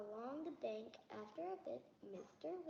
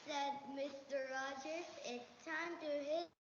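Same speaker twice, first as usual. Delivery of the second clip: louder read-aloud for the listener; 3 semitones higher, 5 dB louder than usual. Acted. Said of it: "Louder, little louder".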